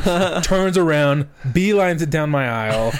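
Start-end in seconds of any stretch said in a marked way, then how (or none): 0.0-0.4 imitated shoe screech. Storyteller imitating his coworker's shoes halting in the aisle, screeched. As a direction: screech like shoes stopping short. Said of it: "You hear the shoes chirp".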